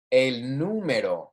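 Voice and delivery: "El número" with the first syllable NÚ stressed, said slowly. Shown as a demonstration. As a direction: lean on the NÚ of "El número". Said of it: "I'm accentuating".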